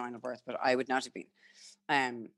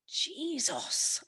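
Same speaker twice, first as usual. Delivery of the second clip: high, drawn-out mock disbelief; imitated shock; 12 semitones higher, 4 dB quieter than usual. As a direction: imitate a partner reeling, high-pitched. Retold partner's shocked reaction.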